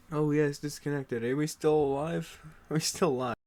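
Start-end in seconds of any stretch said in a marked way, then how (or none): none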